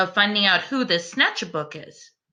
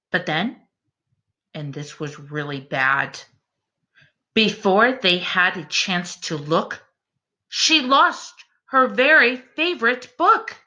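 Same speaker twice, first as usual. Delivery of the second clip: slow, lilting bedtime rhyme reading; story narration; 3 semitones lower than usual. A narrator's reading. Reading a rhyming bedtime book to the class, gentle storyteller voice. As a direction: read it like a picture book to little ones.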